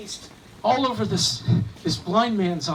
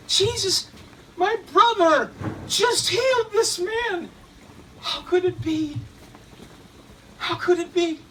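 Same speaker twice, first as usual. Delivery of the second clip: much higher, louder cry of wonder; healed man crying out to the crowd in amazement; amazed shout; 9 semitones higher, 3 dB louder than usual. Acted. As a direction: shout in disbelief while dancing.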